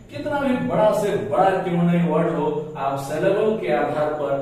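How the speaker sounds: ordinary delivery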